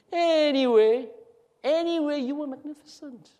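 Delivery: exaggerated lilting echo of a compliment; mimicking the tone. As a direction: stretch it out high, then fade. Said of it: "Why did you say it like that?".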